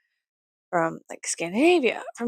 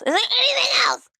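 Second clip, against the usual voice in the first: high squeaky repeat of the question; mock high squeak; 5 dB louder than usual. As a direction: toss the question up in a silly high voice. Mock repeating own question higher.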